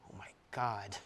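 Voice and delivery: scared breathless whisper. Retold or imitated. Narrator seeing the menacing stranger, frightened whisper. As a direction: whisper it in sudden dread.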